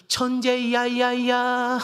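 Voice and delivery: high, loud, long drawn-out echo; imitated mountain echo. Mountain echoing the child's shout back, drawn-out imitation. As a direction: stretch it slowly, repeating the tail like an echo. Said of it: "이렇게 소리가 싹 들려오는 거예요".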